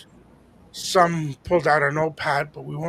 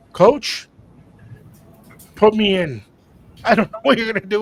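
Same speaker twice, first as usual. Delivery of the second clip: louder, pumped-up plea to the coach; raised, keen call to be put in; eager plea; 5 semitones higher, 8 dB louder than usual. Retold or imitated. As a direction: sell it like a benchwarmer begging to play. Begging coach to pick him.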